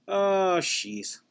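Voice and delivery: ordinary talk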